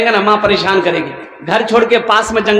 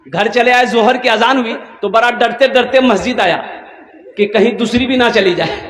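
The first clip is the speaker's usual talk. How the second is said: ordinary talk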